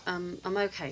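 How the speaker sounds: normal talking voice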